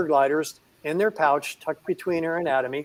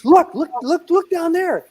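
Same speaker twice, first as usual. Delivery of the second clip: much higher, louder eager urging; eager showing off; 13 semitones higher, 7 dB louder, slower than usual. Retold or imitated. Owner urging the man behind to peek, high and excited. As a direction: proudly urge them to look. Owner proudly shows the gliders.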